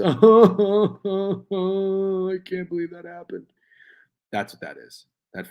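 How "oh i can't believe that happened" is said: mock laughing-crying. Acting out the emoji's face.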